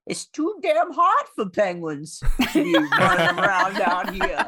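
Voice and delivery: high, slow squawky penguin voice. Acted. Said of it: "you gotta do it in the penguin voice".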